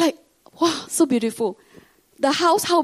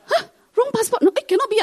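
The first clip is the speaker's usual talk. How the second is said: high, mock shocked protest; disbelief; 11 semitones higher, faster than usual. Teller at the airport counter, seeing business class, in high mock shock. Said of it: "I like".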